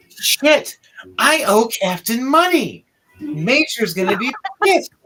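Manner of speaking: lightly voiced shocked guard, then grumbling; archer's dismay. Archer seeing the warcaster, fretting about owing money. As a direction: mutter to yourself in disbelief.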